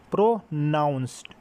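"प्रोनाउंस्ड" slowly split into its parts, pro... nounced; syllable-by-syllable demo. Shown as a demonstration. Teacher showing students how a word is said in pieces, "प्रोनाउंस्ड" split slowly by syllable. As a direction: break "प्रोनाउंस्ड" into slow, separate syllables. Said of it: "किस तरह हम किसी word को जो है वो separately करते हैं".

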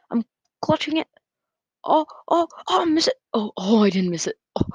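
rising panic on a close jump; excited. Real, not acted. Nail-biting jump in game.

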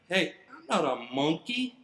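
usual speaking voice

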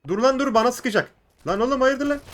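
high, loud startled yelling; jolted alarm; alarmed shout. Real, not acted. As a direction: caught off guard under fire. Under fire, alarmed.

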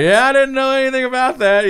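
mock dad interview voice. Mocking dad's mumbled interview.

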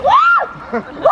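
high, exhilarated whoops breaking into laughter; cold-plunge whoop and laugh. Real, not acted. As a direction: shriek "woo" from the shock of cold water. Just jumped into a cold river.

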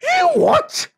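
very high, loud screech of disbelief; cat's furious squawk; outraged cat. Acted. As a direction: explode in an affronted shriek. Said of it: "And the cat said".

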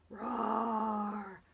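long drawn-out pretend roar; dinosaur roar. Teacher showing children how a dinosaur roars, drawn-out pretend roar. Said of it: "Can you roar?".

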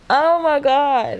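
slow, stretched blissful oh my God; blissful exclamation. Real, not acted. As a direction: savour it out loud. Savouring great sleep.

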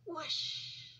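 plain reading voice

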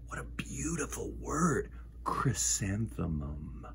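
soft, slow whispered admiration. Real, not acted. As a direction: savour the word in a whisper.

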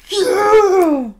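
high, loud whoop; hyped cheer. Real, not acted. Streamer cheering for Smash boards, hyped whoop. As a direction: let out a long rallying yell.

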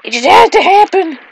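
high, whiny exasperation; exasperated. Real, not acted. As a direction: complain to the sky. Exasperated at mishap.

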